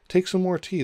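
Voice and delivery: high, loud, pressing offer; very earnest. A narrator's reading. March Hare urges tea on Alice, earnestly. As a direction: urge it keenly, starting high. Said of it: "said to Alice very earnestly".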